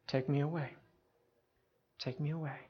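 soft, subdued acceptance; calm surrender. Retold or imitated. Jesus yielding to his captors, calm and quiet.

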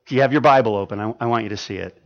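ordinary delivery

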